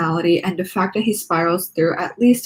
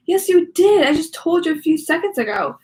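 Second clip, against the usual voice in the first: annoyed; 9 semitones higher than usual. Acted. Annoyed teacher reply.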